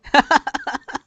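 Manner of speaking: loud high-pitched laugh. Real, not acted. Laughing at parents.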